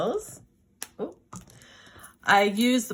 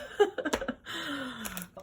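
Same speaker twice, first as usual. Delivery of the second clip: light laugh; 7 semitones higher, 6 dB quieter than usual. Real, not acted. Laughs about Canada prices.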